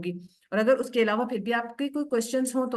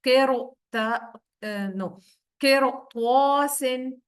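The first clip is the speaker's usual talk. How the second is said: Quran recitation; 2 semitones higher, 3 dB louder, slower than usual. Shown as a demonstration. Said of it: "जैसे".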